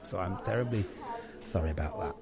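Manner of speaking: low, soft, halting sorry; subdued apology. Acted. Host to customer after an outburst, subdued. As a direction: sink quietly into the apology.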